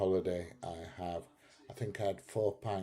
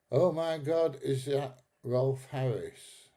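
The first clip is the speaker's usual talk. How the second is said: mock gasp; 5 semitones higher, 4 dB louder, slower than usual. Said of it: "What's this?".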